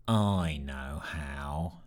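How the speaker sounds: a dull low voice muttering. Acted. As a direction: mutter it flat, unheard. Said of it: "muttered a dull voice".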